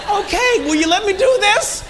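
high, loud comic exasperation; exasperated outburst. Retold or imitated. Father trying to apologise to his teen, mock-exasperated. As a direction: burst out, mock-frustrated, for laughs. Retold botched apology to daughter.

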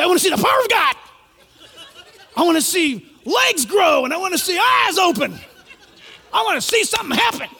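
loud, high mock demand for miracles, with a laugh; mocking shout. Preacher mocks thrill-seekers demanding miracles, loud and high. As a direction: shout it like a crowd hungry for a show. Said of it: "A lot of people say".

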